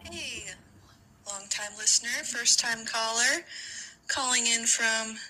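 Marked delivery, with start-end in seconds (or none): none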